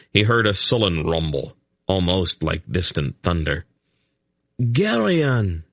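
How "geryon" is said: high alarmed call; crying out. A narrator's reading. Laldoran to Geryon in battle, crying out. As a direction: cry his name in warning. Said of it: "Laldoran cried".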